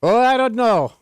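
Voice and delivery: bad attempt at Swedish accent. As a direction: try a Swedish accent and miss. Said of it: "I don't know what that accent was".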